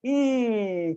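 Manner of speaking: stretched E sound; E mouth shape. Shown as a demonstration. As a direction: spread lips wide on the E. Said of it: "Let's try this one out".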